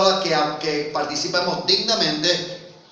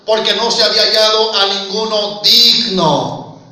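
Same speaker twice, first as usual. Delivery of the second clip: fervent preaching; 5 dB louder than usual. Acted. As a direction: proclaim each line to the whole church. Preaching scripture at full force. (predicación fervorosa en voz alta)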